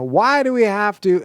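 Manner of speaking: high, drawn-up exasperated "why"; complaining, high-pitched protest; demanding why. Shown as a demonstration. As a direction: challenge the rule, pitch jumping up on WHY. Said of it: "I want you to actually say".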